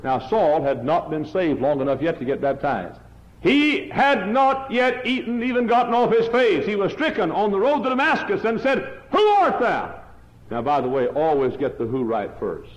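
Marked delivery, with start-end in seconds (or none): 3.4-9.9 preaching at full force. Acted. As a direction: swell the story to a cry. Preacher builds the Damascus scene.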